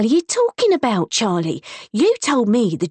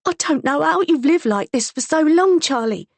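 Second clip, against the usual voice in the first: holding back tears; 11 semitones higher, 3 dB louder than usual. A narrator's reading. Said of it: "she muttered hoarsely, fighting back tears".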